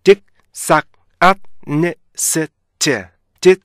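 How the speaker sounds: "딕, 싹, 앗, 네, 셋" as separate punched beats, stopped short; syllable by syllable. Shown as a demonstration. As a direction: chop dishonest into stop-sound syllables, loud and high, pausing after each. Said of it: "원어민이 생각하는 음절 구분으로 나눠서". (음절마다 끊어서 또박또박 "딕, 싹, 앗, 네, 셋")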